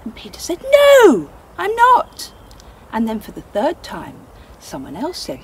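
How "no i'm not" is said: emphatic denial. Acted. Peter denies Jesus again.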